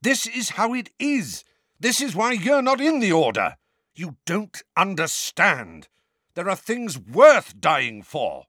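high and hard, slowing at the end; angry lecture. A narrator's reading. Sirius angrily lecturing the twins, high and hard. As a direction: drive each point home, slowing down. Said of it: "equally angry".

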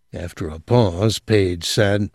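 ordinary delivery

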